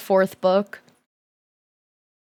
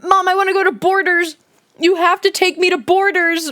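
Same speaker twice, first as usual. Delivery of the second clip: put-on excited kid begging; 8 semitones higher, 3 dB louder than usual. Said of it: "I said".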